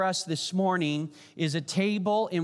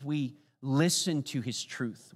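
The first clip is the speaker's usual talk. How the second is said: ordinary talk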